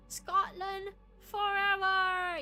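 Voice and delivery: slow mock Scottish cry; drawn-out Scottish war cry; Scottish accent. Streamer showing off her Scottish attempt, slow Scottish cry. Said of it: "learn Scottish accent".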